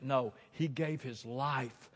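usual speaking voice

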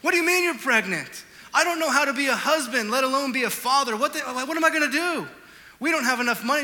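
put-on frightened young husband blurting it out; retold panic. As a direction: relive the terror of the news. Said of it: "I was terrified".

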